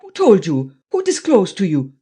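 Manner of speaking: put-on loud, startled German-accented demand; spluttering. A narrator's reading. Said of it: "He spluttered out again".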